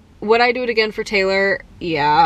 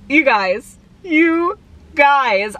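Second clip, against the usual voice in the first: excited emphasis; 6 semitones higher, much slower than usual. Real, not acted. Overwhelmed appeal to viewers.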